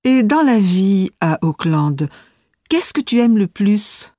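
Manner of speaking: ordinary delivery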